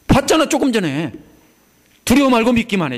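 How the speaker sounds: preacher voicing Jesus' urgent command; emphatic urging. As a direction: push the words out with conviction. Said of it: "이 소리입니다".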